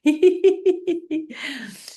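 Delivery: high, rapid staccato laugh; fake laughter. Shown as a demonstration. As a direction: force out rising ha-has while tapping. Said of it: "Making your laughing sounds".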